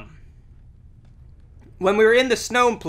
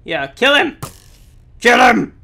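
loud, higher egging on; excited cheering; 5 semitones higher, 10 dB louder than usual. Real, not acted. Gamer urging his ally in battle, loud cheer.

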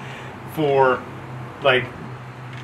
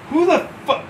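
high indignant challenge; 9 semitones higher than usual. Retold or imitated. Retelling his own challenge.

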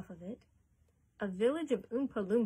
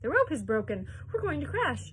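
loud outcry, rising higher at the end; alarmed cry; 5 semitones higher, 6 dB louder than usual. A narrator's reading. Missus T V fearing the elevator will crash, alarmed cry. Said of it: "Missus T V cried out".

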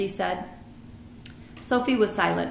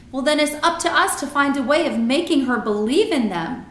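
put-on determined girl, higher and louder; Sophie; 6 semitones higher, 6 dB louder than usual. A narrator's reading. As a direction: push the plan with firm resolve.

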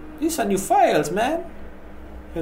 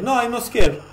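higher, louder protest; defensive, raised-pitch denial; indignant denial; 7 semitones higher, 5 dB louder than usual. Real, not acted. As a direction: push back hard against the claim. Denies what game text says.

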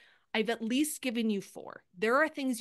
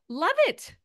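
bright burst of delight, pitched higher and louder; excited; 8 semitones higher, 5 dB louder than usual. Real, not acted. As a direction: cheer the idea on with real delight. Enthusiastic reaction to viewer idea.

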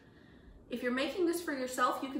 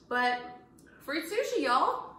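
excited; 7 semitones higher, 6 dB louder than usual. Real, not acted. Enthusiastic video sign-off.

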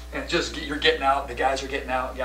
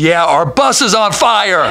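loud, higher, laughing caller; frantic caller; 7 semitones higher, 10 dB louder, slower than usual. Retold or imitated. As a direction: blurt it to the dispatcher. Retold 911 call.

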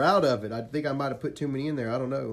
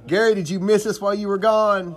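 hyped greeting; 6 semitones higher, 7 dB louder than usual. Real, not acted.

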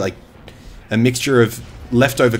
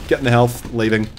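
normal talking voice

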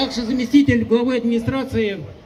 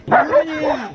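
barked parade-ground commands, pitch jumping up; shouting drill sergeant; 4 semitones higher, much slower than usual. Real, not acted. Parade commands at dog show.

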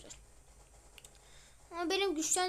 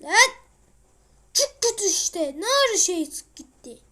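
frustrated outburst; 4 semitones higher, 10 dB louder than usual. Real, not acted. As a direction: groan it out at the game. Game going badly, frustrated.

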